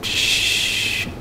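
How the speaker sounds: long hushed hiss of amazement; drawn-out whistling-breath "psshh"; awed "psshh". Retold or imitated. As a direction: imitate someone blown away, letting out "pshhh". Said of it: "ההוא ככה".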